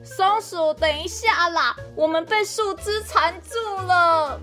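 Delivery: whining. Acted. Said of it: "队友们又再次哀嚎".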